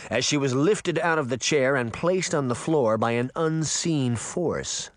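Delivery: plain reading voice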